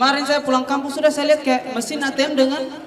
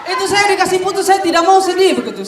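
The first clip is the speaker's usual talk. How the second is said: loud, higher-pitched comic protest; comic shout; 5 semitones higher, 5 dB louder than usual. Acted. Comedian to audience after the breakup, loud comic shout. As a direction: belt it out as a mock-proud rant.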